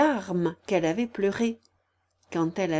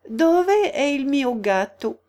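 slow, louder, higher careful Italian; Italian phrase; 6 semitones higher, 6 dB louder, slower than usual. A narrator's reading. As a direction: sound out textbook Italian, word by word. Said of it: "essayer de lui parler italien". (italien appliqué, lent et plus fort)

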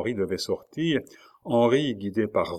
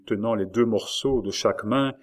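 usual speaking voice

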